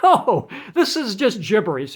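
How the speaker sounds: laughing through the words. Real, not acted. Vlogger laughing at his own gibberish demo, laughing. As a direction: laugh it off, amused at himself.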